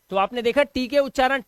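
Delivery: ordinary talk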